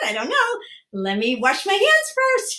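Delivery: high, drawn-out words spilling into laughter; mock hilarity on every phrase; pretend giddy laughing. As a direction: play it silly and giggly. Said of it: "we're gonna laugh".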